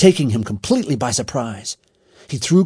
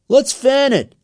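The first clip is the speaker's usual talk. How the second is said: put-on high, loud boyish call; raised, urgent voice; eager call; 13 semitones higher, 6 dB louder than usual. A narrator's reading. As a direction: pitch in with a shout. Boy calls out at fire.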